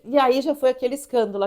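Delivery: normal talking voice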